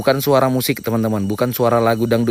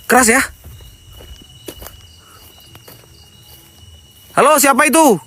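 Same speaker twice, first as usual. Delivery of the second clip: calling out; 9 semitones higher than usual. Real, not acted. Startled ghost hunter shouting at voices in the forest. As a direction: shout to whoever is out there.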